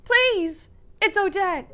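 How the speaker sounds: high, loud desperate shout; screamed call for help. Acted. Odette screams to people up ahead, desperate. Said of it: "she screams".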